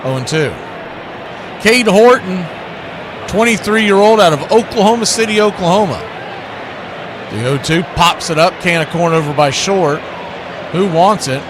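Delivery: play-by-play. Acted. Calling the game play-by-play.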